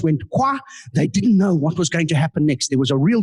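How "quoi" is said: loud French "Quoi". Retold or imitated. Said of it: "in a loud French voice".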